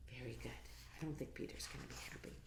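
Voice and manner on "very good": ordinary delivery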